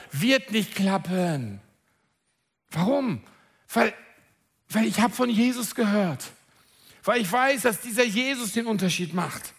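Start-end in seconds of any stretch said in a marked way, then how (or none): none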